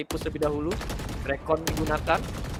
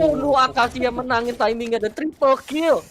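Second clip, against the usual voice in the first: hyped shout; 8 semitones higher, 6 dB louder than usual. Real, not acted. Clutch round decided.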